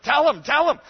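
high, loud mock urging; insistent mother. Upset mother to her scared daughter, hammed-up insistence. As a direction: imitate a mother pushing her child to speak up. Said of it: "she was so insistent".

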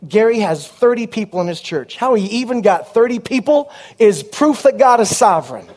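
ordinary delivery

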